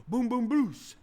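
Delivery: high, loud crowd-rousing holler; hearty shout. Retold or imitated. Preacher retells blurting to a lively congregation, hearty shout. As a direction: boom it out to a whole room.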